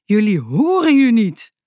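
an angry man calling out, mildly performed; called out. A narrator's reading. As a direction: call it out at the neighbours. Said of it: "riep een man".

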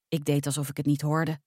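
plain reading voice

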